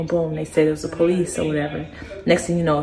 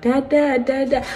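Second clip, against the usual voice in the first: higher, lightly voiced toddler calling; child calling dad; 7 semitones higher, slower than usual. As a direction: call for dad like a little child. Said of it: "trying to get out the room".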